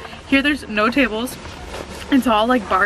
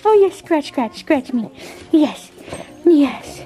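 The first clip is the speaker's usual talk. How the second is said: higher, louder doting pet voice; 5 semitones higher, 4 dB louder, slower than usual. Real, not acted. Owner to puppy scratching at her, doting baby talk. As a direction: gush to an excited dog, pitched up.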